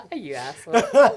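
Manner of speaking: laughing. Real, not acted. Teasing after friend's joke.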